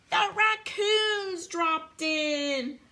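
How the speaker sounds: high, drawn-out storybook announcement; excited reveal. A narrator's reading. Reader announces newcomers to child, drawn-out excitement.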